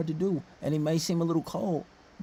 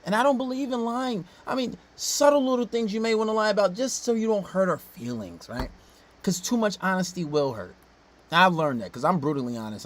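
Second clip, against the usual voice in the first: ordinary delivery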